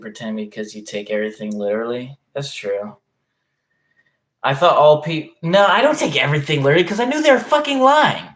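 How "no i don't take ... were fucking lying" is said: loud, high, quick protest; indignant denial. Real, not acted. Streamer rebutting a viewer who says they take everything literally, indignant. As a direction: push back fast and loud, pitched up.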